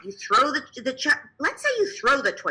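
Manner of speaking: ordinary delivery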